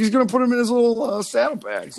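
a high, fast mock of dad. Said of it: "He's like".

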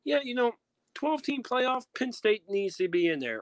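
high mocking voice of a casual fan; high-pitched imitation of a clueless fan. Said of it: "the casual fans are not gonna be like".

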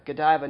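Godiva said as guh-DIE-vuh; Godiva with a long I, guh-DIE-vuh; alternative pronunciation. Shown as a demonstration. Host listing the product's possible names, Godiva as guh-DIE-vuh. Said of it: "not Godiva".

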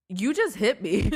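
loud, punched accusation; retold yelling. Driver confronting the lady who hit her, yelling. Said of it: "after I yelled at her".

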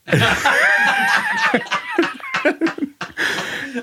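big high laugh bursting out. Real, not acted. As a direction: let out a huge hooting laugh. Big laugh after punchline.

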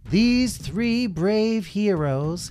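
high, slow, stately announcement; grand king speech. Acted. Red King addressing the armies, slow grand voice. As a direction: proclaim it slowly and grandly.

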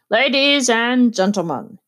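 orchestra leader calling out to the crowd; a lightly voiced stage announcement; announcer's cry. A narrator's reading. Orchestra leader addressing the garden party, lightly calling out. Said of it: "he cried".

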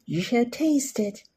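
ordinary talk, nothing marked

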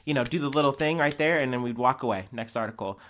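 normal talking voice

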